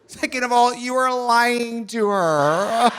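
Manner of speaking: sassy retort. Retold or imitated. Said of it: "I was like".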